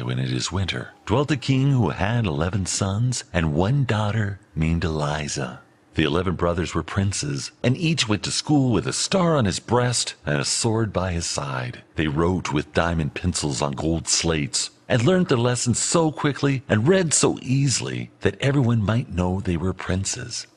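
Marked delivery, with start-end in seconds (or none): none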